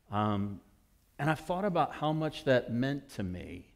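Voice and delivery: usual speaking voice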